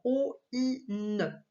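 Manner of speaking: "O I N" slowly, each sound on its own; separated vowels. Shown as a demonstration. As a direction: keep o and i apart, then ne, in "O I N". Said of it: "On n'entend pas du tout oie".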